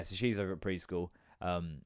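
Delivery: normal talking voice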